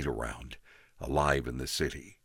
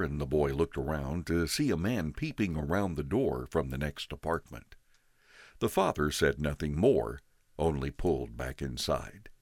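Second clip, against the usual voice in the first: ordinary delivery, nothing marked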